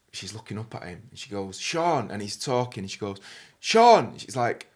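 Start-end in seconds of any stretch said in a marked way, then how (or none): 3.6-4.2 imitating a louder, higher second call; insistent call. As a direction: call up to someone who hasn't answered. Customer calls him a second time.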